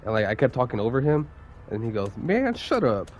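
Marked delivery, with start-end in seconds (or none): 2.2-3.1 imitating a fed-up supervisor, higher pitched; mock an exasperated boss cutting someone off; retold irritated outburst in a raised pitch. Acted quarrel, supervisor snapping.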